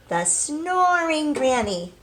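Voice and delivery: put-on read-aloud emphasis for children; drawn-out storybook phrase. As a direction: savor the word snoring like a sleepy story. Teacher dramatizes sleepy story character.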